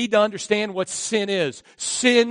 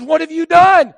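high, loud demanding question; God confronting the culprit; loud stern demand; stern shout; 10 semitones higher, 11 dB louder than usual. Acted.

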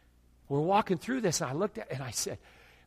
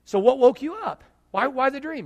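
high, louder disbelieving questions; incredulous questions; 10 semitones higher, 8 dB louder than usual. Retold or imitated. Preacher to his friend, high and pressing in disbelief. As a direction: press him, amazed he isn't healed.

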